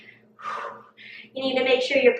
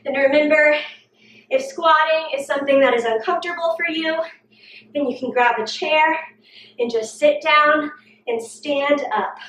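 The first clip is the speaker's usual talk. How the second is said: ordinary talk, nothing marked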